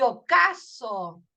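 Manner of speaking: OCASO slowed, with a strong CA; stress on the A. Shown as a demonstration. Teacher matching ocaso to paso, A stressed slowly. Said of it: "la A es la que se pronuncia más fuerte".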